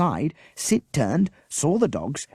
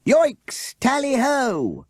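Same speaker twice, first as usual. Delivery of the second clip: high, loud hunting cry; hunting shout; 5 dB louder, much slower than usual. A narrator's reading. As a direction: whoop off the chase. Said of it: "shouted the leader of the hunt".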